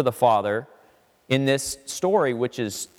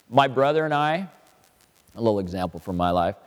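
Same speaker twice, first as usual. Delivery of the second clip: normal talking voice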